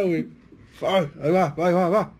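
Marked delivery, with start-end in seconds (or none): none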